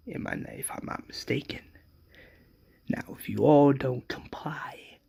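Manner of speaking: very soft, whispered menace; hushed threat. Acted. Governor warns Rick's outnumbered people to comply, hushed menace. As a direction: whisper it like a villain's warning.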